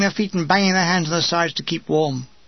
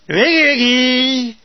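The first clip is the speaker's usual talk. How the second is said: slow, loud drawn-out Wiggy, wiggy; wake-up call; 4 semitones higher, 4 dB louder, slower than usual. Retold or imitated. Sergeant rousing soldiers before dawn, slow loud call. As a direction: bellow Wiggy, wiggy to rouse a sleeper.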